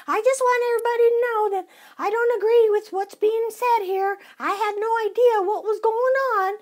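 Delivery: high, loud whiny mock denial; hammed-up innocent excuses; mocking whiny protest. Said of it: "All she kept saying was".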